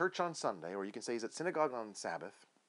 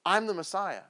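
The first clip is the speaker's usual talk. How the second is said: louder, punched declaration; emphatic proclamation; 3 semitones higher, 6 dB louder than usual. Retold or imitated. Jesus proclaiming himself the Messiah, emphatic and loud. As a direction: proclaim it with weight on each key word. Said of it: "said it with an emphasis on himself and then proclaimed".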